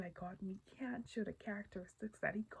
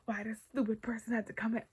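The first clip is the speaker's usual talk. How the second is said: retold annoyance; 7 dB louder than usual. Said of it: "I just get so mad internally".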